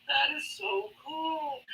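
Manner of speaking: high, slow gush of awe; retold delight. Telling a friend about the concert, high slow awe. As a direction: stretch it out, starstruck. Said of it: "I was like".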